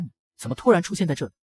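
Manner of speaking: ordinary delivery, nothing marked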